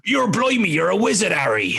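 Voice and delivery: high, hammed-up West Country accent; West Country Hagrid. Dad acting out Harry Potter at bedtime, West Country accent. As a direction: put on a big storybook West Country accent. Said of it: "It's that West Country accent".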